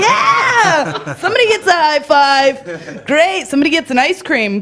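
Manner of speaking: put-on high, gushing praise for a child; mock celebration for a little kid; hammed-up cheering. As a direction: cheer like a proud grown-up, over the top. Acted adult cheering on a child.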